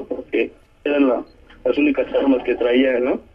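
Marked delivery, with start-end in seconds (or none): none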